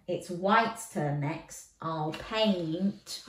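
plain reading voice

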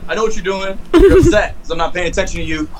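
high, sing-song scolding of a pet; talking to the dog. Real, not acted.

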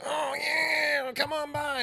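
very high, loud mock holler; spaced-out dealer. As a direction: mimic a man on another planet hollering. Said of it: "gone to another fucking planet".

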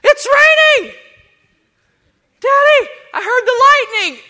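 put-on high, loud crying child shouting for Daddy; scared small child. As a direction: wail like a frightened kid woken by thunder. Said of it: "my six-year-old and my three-year-old".

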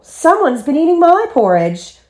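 high, indignant mother bear; astonished storybook mother, high-voiced; Mommy Bear exclaiming. A narrator's reading. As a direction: exclaim it with pitch jumping up. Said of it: "she exclaimed".

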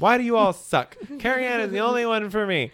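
hammed-up grumble about bad coffee; mock complaint. Boss griping to his staff about the coffee, mock whiny. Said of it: "And then I'm like".

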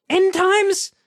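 shocked question; high disbelieving end times; incredulous. Real, not acted. Shocked echo.

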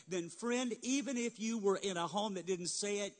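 ordinary talk

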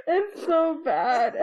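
high-pitched, drawn out through helpless laughter; laughing hard. Real, not acted. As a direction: laugh your way through the words. Laughing at played clip.

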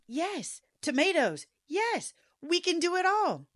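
high, eager acted enthusiasm; excited agreement. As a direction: cheer each idea on in a high voice. Playing along with excited husband.

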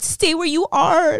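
high, hammed-up tearful wail; crying in panic. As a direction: re-enact blubbering while freaking out. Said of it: "crying 'cause I'm freaking out".

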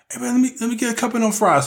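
put-on high, fast wheedling girlfriend voice; mock girlfriend asking. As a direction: squeeze out a sweet quick request for fries. Voicing woman asking for fries.

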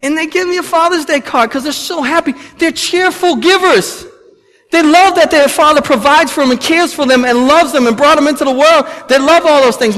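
high, loud preaching push; fervent preaching. Acted. Pastor to congregation about cheerful giving, fervent preaching. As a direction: drive the point home to the congregation.

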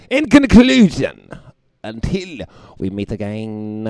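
mock grand announcer.

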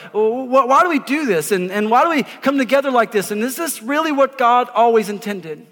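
usual speaking voice

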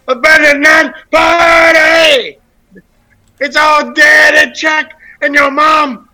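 loud, high and drawn out; hammed-up announcer yell; host hyping a joke like an arena announcer; mock hype shout.

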